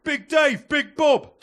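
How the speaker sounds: loud, high hail across the pub; hearty shouted greeting. Acted. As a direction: call out the nicknames like a rowdy mate. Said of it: "someone will come in and go".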